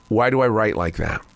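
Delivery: ordinary talk, nothing marked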